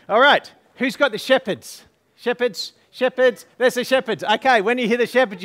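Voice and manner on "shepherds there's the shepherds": ordinary talk, nothing marked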